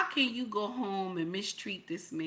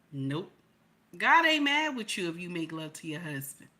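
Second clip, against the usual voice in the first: normal talking voice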